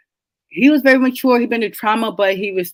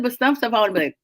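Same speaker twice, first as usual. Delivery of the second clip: normal talking voice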